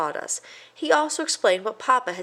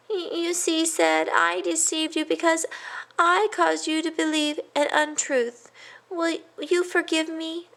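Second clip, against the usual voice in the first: apologetic mouse; 10 semitones higher than usual. A narrator's reading. A mouse humbly asks his cousin's forgiveness, high.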